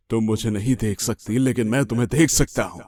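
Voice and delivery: low, menacing male stalker voice; sinister intruder. Acted. Unseen man taunting a woman in the dark, low and sinister.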